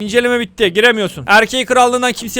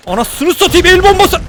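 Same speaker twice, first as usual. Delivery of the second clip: alarmed cry; 9 semitones higher, 4 dB louder than usual. Real, not acted. Grenade alarm.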